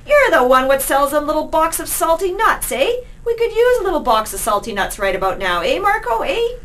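high, loud, brash cockney call; a bold street rat heckling the Beggar King. Acted. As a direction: heckle the stranger loudly, climbing at the end. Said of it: "he called to the Beggar King".

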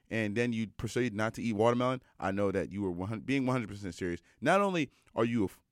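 ordinary talk, nothing marked

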